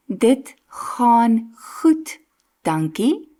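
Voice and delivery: Dit gaan goed, dankie said very slowly for learners; slow clear demo. Shown as a demonstration. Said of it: "put it into real sentences".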